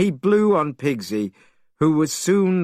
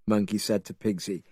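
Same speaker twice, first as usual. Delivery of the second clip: ordinary delivery, nothing marked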